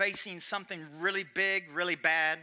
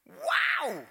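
amazed wow; 8 semitones higher, 4 dB louder than usual. Retold or imitated. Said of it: "I just went".

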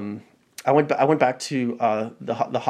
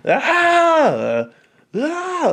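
long, very high mock yell; high held "aah" mimicking an endless argument; hammed-up high scream; imitated arguing yell; 4 dB louder than usual. Imitates both sides yelling in argument.

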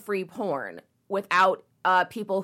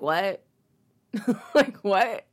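ordinary delivery

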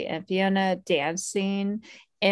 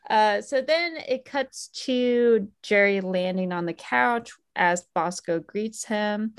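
ordinary delivery

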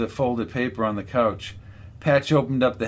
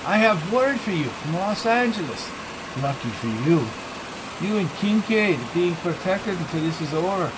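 lightly voiced Spanish-accented thug; a hint of a Spanish accent, curt; small man with a Spanish accent giving orders; 5 semitones higher than usual. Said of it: "His thick Spanish accent".

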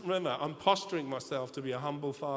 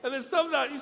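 ordinary delivery

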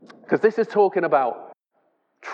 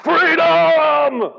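high, loud drawn-out cry of freedom; soaring mock war cry; Braveheart battle cry; 13 semitones higher, 6 dB louder than usual. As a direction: shout it like a rebel leader rallying his men. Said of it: "I love a bit of Braveheart".

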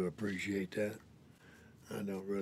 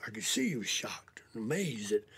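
ordinary talk

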